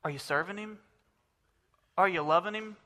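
softly repeated question. Acted. Preacher repeats his challenge softly.

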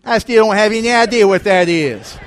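loud, high-pitched comic bafflement; exasperated dad. Retold or imitated. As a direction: imitate Dad giving up in loud confusion. Dad still baffled, punchline.

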